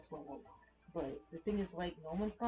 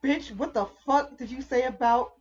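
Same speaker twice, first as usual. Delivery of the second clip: loud, laughing mock yell at a partner; mock furious yell; 7 semitones higher, 11 dB louder than usual. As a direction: yell it half laughing, hammed-up rage. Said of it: "It's like".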